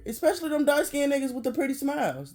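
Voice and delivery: normal talking voice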